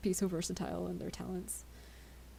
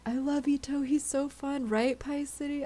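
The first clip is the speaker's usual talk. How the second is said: gushing, higher and louder; excited; 7 semitones higher, 5 dB louder than usual. Real, not acted. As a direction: gush about him. Gushing about favourite character.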